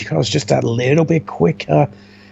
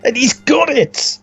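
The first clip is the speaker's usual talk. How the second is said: excited; 11 semitones higher than usual. Real, not acted. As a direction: yell the win in delight. Commentator calls the win.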